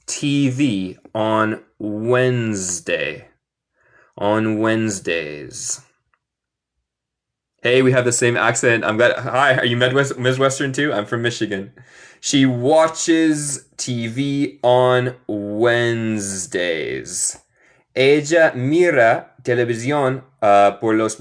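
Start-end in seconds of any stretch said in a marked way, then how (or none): 0.1-5.8 slow, stretched words with gaps; slow model reading. Shown as a demonstration. Teacher repeats the sentence for students, slow model reading. 12.2-16.6 slow, every word drawn out; slow model reading. Shown as a demonstration. Teacher returns from chat to the example sentence, slow model reading.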